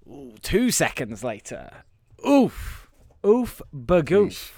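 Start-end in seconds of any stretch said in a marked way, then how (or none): 2.3-4.5 silly oof. Real, not acted. Silly groan.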